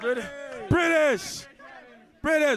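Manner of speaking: high, loud chant; excited shout. Pretend. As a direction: shout it to rouse the crowd. Chanting British with the crowd.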